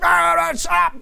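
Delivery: high, loud mock yell of a fed-up parent; a worn-out dad yelling at his kids to shut up. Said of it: "starts turning into yelling".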